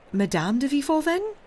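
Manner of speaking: high, rising question; anxious guessing. A narrator's reading. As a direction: voice climbing sharply to the last word. Granddaughter guesses who angered him.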